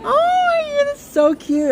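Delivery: very high squeal, easing down; gushing over cuteness. Real, not acted. Telling the owner the dog is cute, gushing. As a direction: squeal it, melting with adoration.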